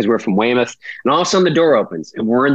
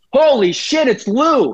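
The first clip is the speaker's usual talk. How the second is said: hyped ballplayer yelling at a movie star; excited Pedroia; 10 semitones higher than usual. Retold or imitated. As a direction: burst out like a starstruck ballplayer. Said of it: "In comes Pedroia".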